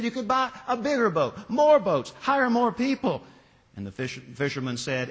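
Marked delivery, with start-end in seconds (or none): 0.0-3.2 higher, pushy and irritated; irritated businessman. A narrator's reading. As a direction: press him, losing patience. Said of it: "the businessman was getting irritated".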